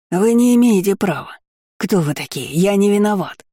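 snivelling protest. A narrator's reading. Shopkeeper on his knees before the crowd, snivelling protest. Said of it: "Наматывал сопли на кулак торговец".

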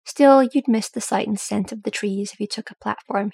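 ordinary delivery

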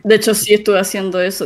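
ordinary delivery

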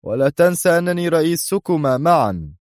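high, pointed reminder; sharp. A narrator's reading. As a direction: pull rank sharply. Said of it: "ثم أضاف في حدة".

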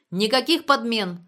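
loud, firm yell; shouted. A narrator's reading. Klyuev barring any shift swaps, shouting. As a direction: bellow it as an order. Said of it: "заорал Клюев".

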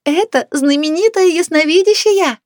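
high, loud proclamation; shouted announcement. A narrator's reading. Neighbour shows off clairvoyant to guests, shouting. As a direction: holler it to the whole room. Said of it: "продолжала кричать Люба проживающим".

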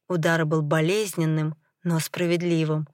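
plain reading voice

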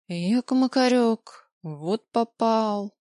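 very slow, drawn-out rueful words; slow rueful sigh. Acted. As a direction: drawl out the self-pity. Rueful aside to himself.

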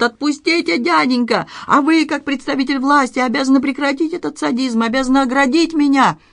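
high, pleading protest; squealing plea. A narrator's reading. Doorman begging uncle and police captain, squealing. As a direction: squeal the complaint, staying high throughout. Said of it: "верещал тот".

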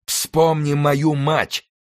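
high, long drawn-out cry; vengeful roar. A narrator's reading. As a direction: hurl it as you gallop past. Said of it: "проревел Мордаунд". (протяжный мстительный рёв)

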